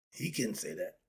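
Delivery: said low and soft, almost to himself; a subdued take, drop the volume; quiet. Shown as a demonstration. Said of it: "See the difference?".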